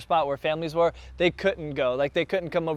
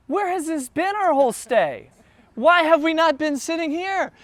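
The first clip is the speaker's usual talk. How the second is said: amazed disbelief; 11 semitones higher, 5 dB louder than usual. Retold or imitated. Amazed guests voiced indignantly.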